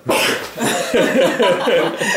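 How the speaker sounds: loud burst of laughter. Real, not acted. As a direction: crack up loudly. Laughing at own joke.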